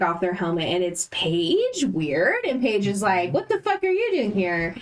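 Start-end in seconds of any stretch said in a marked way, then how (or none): 3.3-4.7 high, quick, incredulous outburst; startled confrontation. Retold or imitated. Paige confronts her weird double in a burning keep, startled. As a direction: voice jumps up in mock disbelief.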